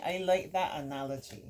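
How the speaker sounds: ordinary delivery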